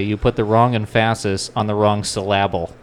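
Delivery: EMPHASIS said "em-FASS-is", SYLLABLE "suh-LAB-ul"; Brian Regan's misplaced stress. Retold or imitated. Quoting a comedian's joke, emphasis and syllable stressed on the middle. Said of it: "as Brian Regan would say".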